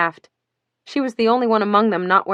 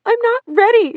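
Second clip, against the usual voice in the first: wailing; 8 semitones higher than usual. Acted. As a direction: build to a wail of panic. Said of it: "she wailed".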